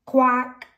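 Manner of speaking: high, loud quack; one sudden high duck call. Acted. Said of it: "a duck".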